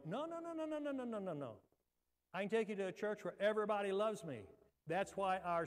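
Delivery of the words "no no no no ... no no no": high-pitched run of no-nos; high, rapid-fire mock protest; rapid dismissal. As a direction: wave it off in a high, sliding voice. Acted quick answer to an objection.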